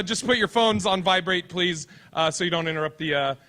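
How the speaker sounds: normal talking voice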